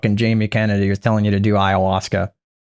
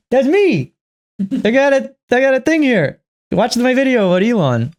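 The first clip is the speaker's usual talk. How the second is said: much higher, mocking a self-promoter; mock Jamie voice; 10 semitones higher than usual. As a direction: parody him plugging his own video.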